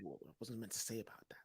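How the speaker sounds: hushed whisper, caught out; real whisper. Real, not acted. Whispering to the host after revealing the ski mask. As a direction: whisper it like you've let something slip. Blurts secret, hushes himself.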